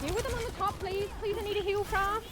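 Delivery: high, whiny begging; urgent pleading. Real, not acted. Gamer begging the healer for rescue, urgent high pleading. Player begs team for help.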